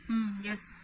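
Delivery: weak mumbled reply; mumbled yes. Shown as a demonstration. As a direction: mumble it under your breath. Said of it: "ಜೋರಾಗಿ ಹೇಳಿಲ್ಲ".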